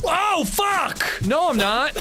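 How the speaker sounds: sudden high yelp of surprise; startled curse. Real, not acted. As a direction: jolt up in pitch, caught off guard. Sudden in-game shock.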